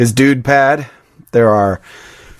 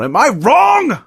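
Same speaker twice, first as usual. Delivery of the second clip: quoting Walter; 11 semitones higher, much slower than usual. Retold or imitated. Walter challenging.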